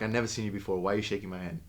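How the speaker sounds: ordinary talk, nothing marked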